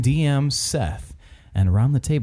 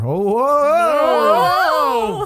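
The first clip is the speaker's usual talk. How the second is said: high hammy whoa; mock surprise; 10 semitones higher, 4 dB louder than usual. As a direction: fake amazement. Mock shock at November.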